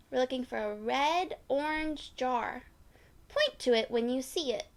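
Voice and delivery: usual speaking voice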